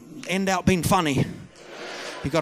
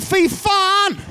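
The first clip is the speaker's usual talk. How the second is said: high, loud appalled swearing; hammed-up horrified outburst; mock shock; 5 dB louder than usual. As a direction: blurt it high and loud in disbelief. Acts own shock at the announcement.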